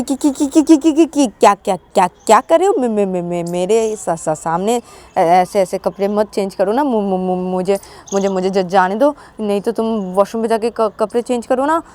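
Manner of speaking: rapid-fire stuttering shock; acted embarrassed panic, tongue-tied; panicky stammer. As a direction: stutter hard, then plead. Panics as roommate undresses.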